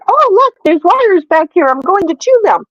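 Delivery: lightly put-on cat voice; the cat talking. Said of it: "The cat is decided".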